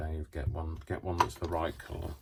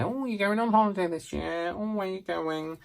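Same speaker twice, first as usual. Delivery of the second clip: chatty nail technician; 12 semitones higher than usual. Acted. Crafter playing someone filing nails and asking about holidays, high mock-chatty voice. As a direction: put on a high, singsong chatty voice. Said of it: "just sit and say".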